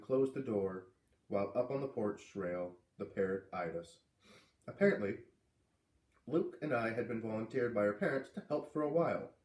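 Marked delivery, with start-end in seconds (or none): none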